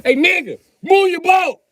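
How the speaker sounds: high, loud holler across the water; loud high call. Acted. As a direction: yell it at the boat. Calling out to the boater.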